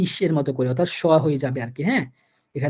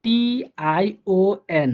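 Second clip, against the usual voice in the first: TION spelled letter by letter, very slowly; drawn-out letter names TION; 3 semitones higher, much slower than usual. Shown as a demonstration. Teacher spelling TION slowly for students. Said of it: "same ভাবে দেখেন".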